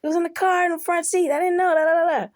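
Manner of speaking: high, loud, rushed good news; retelling your own excited babble; happy excitement. Retold or imitated. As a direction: burst out with relieved excitement. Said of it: "I'm so damn happy".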